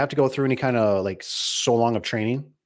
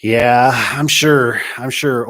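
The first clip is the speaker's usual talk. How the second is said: sarcastic; 9 dB louder, much slower than usual. Real, not acted. Loud sarcastic agreement.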